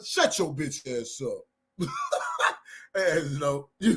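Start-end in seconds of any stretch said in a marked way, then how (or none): 0.0-1.9 low, soft threat; Angelo's menacing voice. Retold or imitated. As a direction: lower and softer, menacing. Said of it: "Oh, Angelo".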